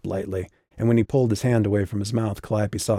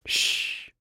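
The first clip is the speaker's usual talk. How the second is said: soft whispered shush; quiet her with a gentle shh; hushing; 5 dB quieter than usual. Acted. Mother hushing her daughter.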